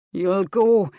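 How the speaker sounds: grim. A narrator's reading. Husband overrules wife, grim and loud. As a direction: lay down the law, grimly. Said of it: "said grimly".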